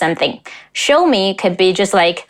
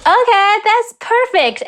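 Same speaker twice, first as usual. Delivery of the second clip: delighted approval; 10 semitones higher than usual. Real, not acted. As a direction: sing out your approval in a much higher pitch. Delighted verdict on a clip.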